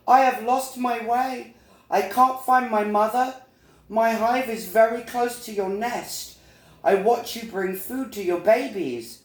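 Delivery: high, earnest little bee; a small lost bee explaining her trouble; high-pitched storybook bee voice; Little Bee, pleading. Acted.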